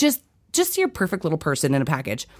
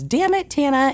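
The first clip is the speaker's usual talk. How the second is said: lightly voiced husband, a bit higher, playfully cursing; husband joking; 10 semitones higher than usual. Husband jokingly cursing at his wife, in a lightly mimicked teasing voice. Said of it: "Chase was joking around about something and goes".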